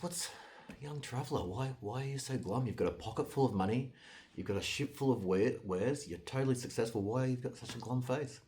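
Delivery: put-on a touch of concerned old-timer; lightly voiced old man. A narrator's reading. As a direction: ask as a curious old man, voice lifted a little. Old man questions the glum traveller.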